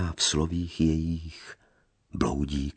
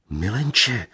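startled cry; 6 semitones higher than usual. A narrator's reading. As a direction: gasp out his name in fright. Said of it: "uleknuta vykřikla Lea".

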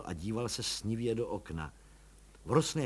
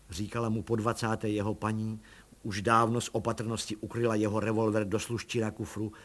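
plain reading voice

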